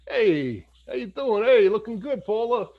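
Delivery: hammed-up Italian-American greaser calling out; Rocky Marciano type. Friend imitating a doo-wop era guy hollering at a girl. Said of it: "Rocky Marciano kinda".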